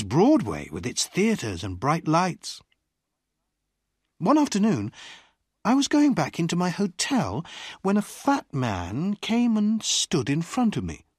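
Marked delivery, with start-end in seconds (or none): none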